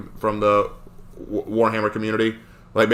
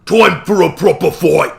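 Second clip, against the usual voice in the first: higher, louder Cockney ork roar; ork battle cry; 7 semitones higher, 6 dB louder than usual. Pretend. Ork voice yelling to chat, wanting a proper fight. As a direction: bellow it like an ork spoiling for a fight.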